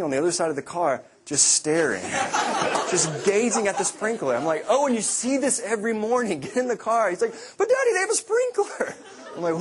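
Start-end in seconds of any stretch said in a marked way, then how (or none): 4.7-7.1 exasperated dad. Retold or imitated. Exasperated father to son. 7.6-8.9 put-on much higher, giggly child pleading; little boy voice. Father imitating his son's excuse in a high, giggly child voice.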